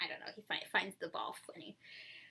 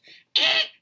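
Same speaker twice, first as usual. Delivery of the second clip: loud, high baby grunt, imitated; imitated baby yell; 13 dB louder than usual. Mother showing how baby yells at her, imitated grunt. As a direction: mimic a baby's scolding grunt. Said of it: "go like this to me".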